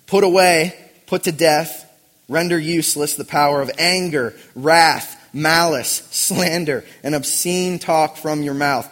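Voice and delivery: loud, punchy preaching delivery; emphatic scripture reading. Acted. Preacher urging put to death anger and slander, emphatic scripture reading. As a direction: hammer out each item for the congregation.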